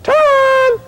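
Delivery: high, very loud call across the neighborhood; a mother hollering for her kid from the porch; calling kids to dinner. Retold or imitated. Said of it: "she'd always pull one of these".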